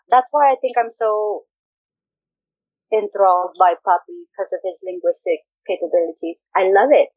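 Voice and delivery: ordinary talk, nothing marked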